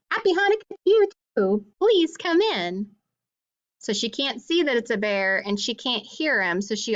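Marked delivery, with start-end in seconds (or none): 0.1-2.9 high, quick, welcoming granny voice, then slowing on the invitation; a cheerful old woman at her door, much higher; old grandmother voice. Acted. Grandmother greeting the rabbi at her door, high and eager.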